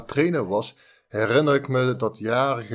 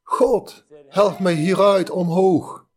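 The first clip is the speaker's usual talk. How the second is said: much higher, loud cry for help; desperate plea; 7 semitones higher, 5 dB louder, slower than usual. Acted. Man on the cliff cries up to God, high and loud. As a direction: beg God to pull you up. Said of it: "En hij zei".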